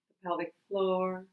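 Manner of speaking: ordinary talk, nothing marked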